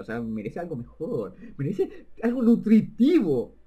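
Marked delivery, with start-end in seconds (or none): 1.6-3.6 laughing emphasis. Real, not acted. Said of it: "laughing emphasis".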